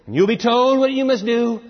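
ringing divine command; commanding heavenly voice. Acted. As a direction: give firm marching orders from on high. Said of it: "The voice continues to speak".

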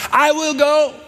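high bright answer of the son; eager son. Acted. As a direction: chirp it like a keen son. Son in parable answers father.

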